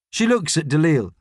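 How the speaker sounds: ordinary delivery, nothing marked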